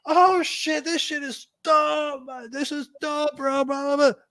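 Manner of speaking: imitating hyped-up crew praising the beat; hyped homie talk. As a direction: sell it like they're blown away. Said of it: "all talking like that".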